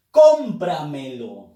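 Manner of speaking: "cómpramelo" lento, con "cóm" fuerte y el resto cayendo; acento en "com". Shown as a demonstration. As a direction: golpea la primera sílaba de "cómpramelo". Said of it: "com lleva la fuerza de voz". ("cómpramelo" pausado, cargando la voz en "cóm")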